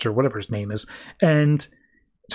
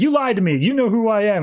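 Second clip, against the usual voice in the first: retold yelling; 7 semitones higher than usual. Superman confronts Catwoman over her lie, mildly yelled. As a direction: accuse in a raised pitch, without really yelling. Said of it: "Superman starts yelling at Catwoman".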